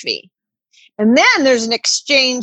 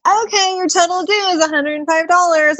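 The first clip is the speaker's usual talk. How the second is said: a lightly voiced chirpy agent, pitched higher; breezy fake-polite agent quoting a fee; mock customer service; 7 semitones higher than usual. Mocking a timeshare agent, in a lightly higher chirpy agent voice. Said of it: "And it's like".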